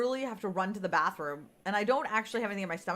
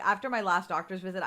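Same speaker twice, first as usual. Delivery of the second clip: ordinary delivery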